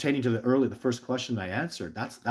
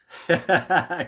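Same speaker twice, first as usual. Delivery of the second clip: short laugh; 10 semitones higher, 13 dB louder than usual. Real, not acted. Laughs at messy paperwork.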